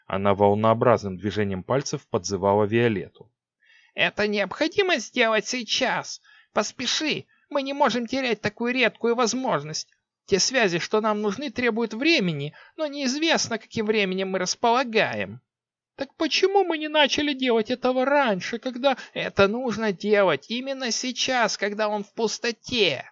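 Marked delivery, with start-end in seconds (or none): 3.9-15.4 high urgent witch voice; urgent sorceress. A narrator's reading. Six urges. 15.9-18.9 put-on very high girlish protest; young queen talking back. A narrator's reading. Queen objects. 19.1-23.1 high urgent witch voice; urgent sorceress. A narrator's reading. Six insists.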